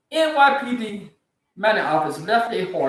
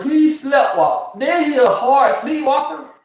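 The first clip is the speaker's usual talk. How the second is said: mocking disbelief; 6 semitones higher, 5 dB louder, slower than usual. Real, not acted. Mocking incredulity at the excuse.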